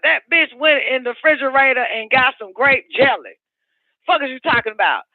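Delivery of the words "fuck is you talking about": usual speaking voice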